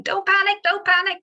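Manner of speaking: mock-frantic Dad's Army panic. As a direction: yelp it like a man losing his head. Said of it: "he'd run around frantically going".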